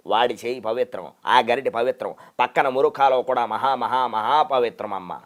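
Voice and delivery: lightly voiced solemn elder lecturing; Garikapati impression. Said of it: "Garikapati గారు వచ్చి మాట్లాడితే".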